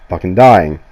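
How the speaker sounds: ordinary delivery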